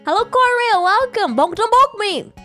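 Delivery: ordinary talk, nothing marked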